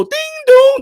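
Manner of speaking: high sing-song ding dong like the train doors; subway chime. Retold or imitated. Imitating subway door chime.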